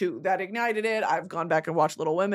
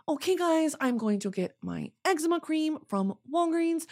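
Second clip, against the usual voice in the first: affected vlogger talk, pitched up and played deadpan; mock influencer voice; 2 semitones higher, 4 dB quieter than usual. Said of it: "Like, that voice".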